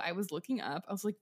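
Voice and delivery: ordinary delivery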